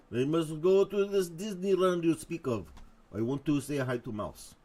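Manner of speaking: hammed-up bad Russian accent; poor Russian accent. Host voicing Soviet leader's park complaint, poor Russian accent. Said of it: "very poor Russian accent impersonation".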